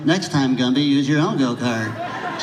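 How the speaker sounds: lightly voiced claymation horse imitation; a hint of the little cartoon horse; Pokey voice. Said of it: "he would talk like this".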